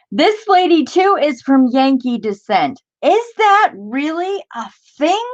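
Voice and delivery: very high, disbelieving pitch; high-pitched baffled amazement; incredulous. Real, not acted. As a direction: read it out in astonishment, then question it. Mock astonishment at the quaint description.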